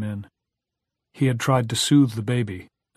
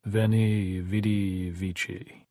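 grave; much slower than usual. A narrator's reading. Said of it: "stood gravely in the door and said".

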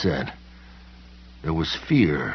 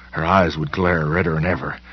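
ordinary delivery, nothing marked

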